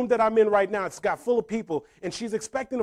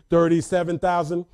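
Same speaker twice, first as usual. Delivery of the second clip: put-on lower-pitched bid in heavy Haitian accent; calm, strong accent; 4 semitones lower, 3 dB louder than usual. Mom calmly outbids the bank, strong Haitian accent. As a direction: imitate mom's thick Haitian accent, composed. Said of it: "collect, with her strong accent".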